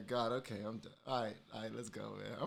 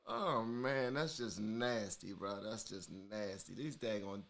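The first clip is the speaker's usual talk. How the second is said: winding down from laughing; 7 semitones lower, 4 dB quieter than usual. Real, not acted. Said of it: "my eyes keep on daggone watering".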